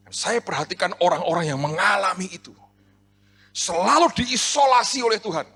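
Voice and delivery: high, loud sermon delivery, building; impassioned preacher rising to a shout; full-force preaching. Real, not acted. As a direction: build to a shouted proclamation for the congregation.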